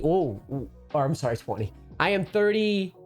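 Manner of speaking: usual speaking voice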